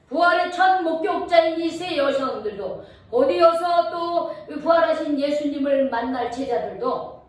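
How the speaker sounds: ordinary delivery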